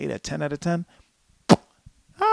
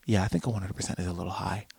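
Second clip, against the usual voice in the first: softer, lower answer to oneself; quiet concession; 6 semitones lower, 12 dB quieter, faster than usual. Acted. Host answers his own question on the score, quietly conceding. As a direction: concede it softly and low.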